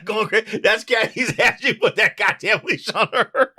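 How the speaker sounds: talking through laughter; laughing. Real, not acted. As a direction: crack up while describing. Laughing at Kathy image.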